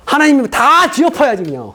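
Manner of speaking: ordinary delivery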